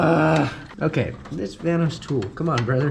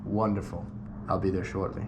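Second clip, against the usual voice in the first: lightly put-on Transylvanian vampire accent; a hint of a Dracula voice on the phone; 5 semitones lower, 8 dB quieter than usual. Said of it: "That's, like, my vampire accent".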